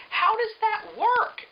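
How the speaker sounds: incredulous. Real, not acted. Incredulous at the book's logic.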